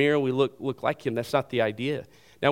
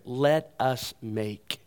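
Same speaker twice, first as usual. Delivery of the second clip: "Let us make" drawn out very slowly, word by word; emphatic; much slower than usual. Shown as a demonstration. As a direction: give each word of "Let us make" its own weight. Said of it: "The reason it's emphatic right there".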